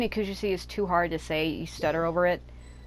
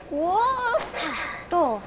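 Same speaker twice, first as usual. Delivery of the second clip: much higher, sharp surprised whoa; startled whoa; 12 semitones higher than usual. Real, not acted. Gamer shocked by a sudden drop, high startled whoa. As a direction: caught off guard by a fall.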